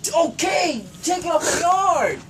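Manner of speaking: high, slow exasperated yell; fed-up yelled demand; angry shout. Real, not acted. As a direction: shout the order, dragging it out. Same demand, patience lost.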